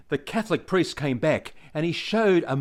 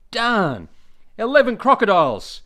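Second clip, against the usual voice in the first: exasperated blonde; 5 semitones higher, 4 dB louder than usual. Acted. Blonde swears at crocodiles.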